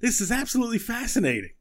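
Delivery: high, wonder-struck voice; high amazed exclamation; fascinated Buttons. Acted. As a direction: marvel at it in a squeaky voice. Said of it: "He's like".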